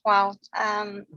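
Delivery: ordinary talk, nothing marked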